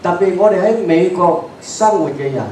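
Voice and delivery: usual speaking voice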